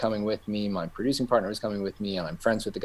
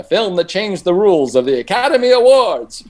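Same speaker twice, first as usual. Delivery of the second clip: movie trailer voice; 10 semitones higher, 11 dB louder than usual. Retold or imitated. Reading the DVD box blurb.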